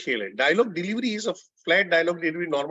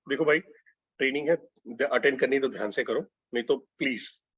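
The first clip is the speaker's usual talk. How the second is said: respectful, softened request; polite, humble; 3 semitones lower than usual. Shown as a demonstration. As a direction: make a gentle, courteous appeal, easing lower. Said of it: "polite, आदर, humble".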